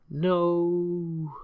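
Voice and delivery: high shocked no; dismayed no. Real, not acted. Boats just got killed.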